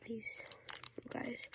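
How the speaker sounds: soft whisper. Real, not acted. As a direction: whisper it to the camera. Whispered aside to viewers.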